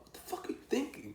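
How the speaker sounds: high-pitched retold outrage; incredulous outburst. Smoker telling off his friend, incredulous. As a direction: scold your buddy in squeaky disbelief.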